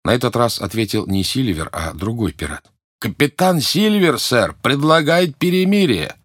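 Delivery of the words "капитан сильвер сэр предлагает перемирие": high, slow call across the yard; rough sailor hailing from afar; pirate calling out. Acted. Pirate calls out to fort.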